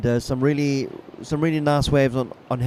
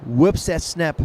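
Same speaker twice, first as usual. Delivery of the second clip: laid-back surfer announcer; 2 semitones higher than usual. Acted. Live play-by-play of ride.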